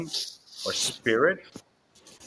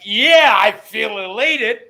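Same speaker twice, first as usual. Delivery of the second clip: high, loud, slow joyful shout; excited; 7 semitones higher, 8 dB louder, slower than usual. Retold or imitated. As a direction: shout it out in church rapture. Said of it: "And I was like".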